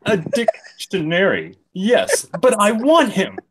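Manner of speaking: high, loud mock whine. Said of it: "I was whining louder now".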